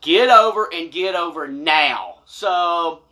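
normal talking voice

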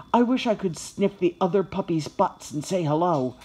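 ordinary delivery

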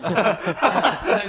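loud laughing protest; laughing loudly. Real, not acted. Laughing protest about feet.

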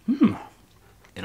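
talking with food in mouth; chewing while talking. Real, not acted. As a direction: mouth partly full. Seen 0: Bite at -4; closed working lips at start, then clearer speech.

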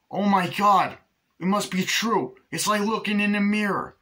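mock amazement. Gushing over twin reveal.

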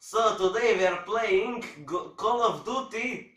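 put-on a gamer's jokey Russian accent intro; mock Russian accent. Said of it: "Why you using this Russian accent?".

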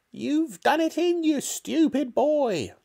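scolding, piercing high granny screech; shouting. A narrator's reading. Grandma blaming George for the hen, shouting. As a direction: berate the boy in a drawn-out squawk. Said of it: "Grandma shouted".

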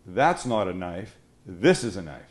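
low, slow Crocodile Dundee line; a hint of a movie Aussie tough guy; lightly put-on Aussie line. Shown as a demonstration. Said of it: "say this for me".

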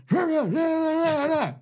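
high barked rah rah rah; high gibberish yell from the left; retold yelling. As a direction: mimic a cop hollering gibberish.

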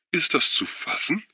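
high, rising incredulous cry; outraged disbelief. Acted. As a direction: throw the question up high in shock. Schuwan outraged after the women.

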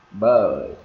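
"bird" with a stretched Vietnamese "ơ"; long "ơ" vowel. Shown as a demonstration. Teacher likening it to ơ, "bird" drawn out. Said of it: "nếu kéo dài ra là".

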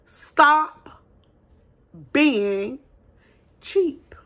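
slow, word by word with long pauses. Shown as a demonstration. To small business owners, repeating the warning slowly word by word. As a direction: space every word out so it sinks in. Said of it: "I'm gonna say it slow".

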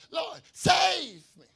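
loud, high, drawn-out plea; acted desperate shout, stretched slow; crying out. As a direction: cry for rescue while sinking. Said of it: "he cries".

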